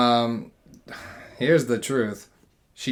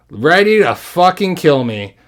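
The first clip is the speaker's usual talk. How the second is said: ordinary delivery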